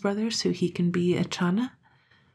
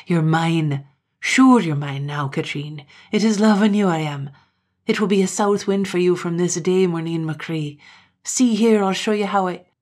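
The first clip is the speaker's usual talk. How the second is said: loud, insistent Highland man, pressing harder; menacing suitor Gloom; 6 dB louder than usual. Acted. Gloom forcing his love on Katrine, loud and menacing. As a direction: claim her, pushing ever louder and faster.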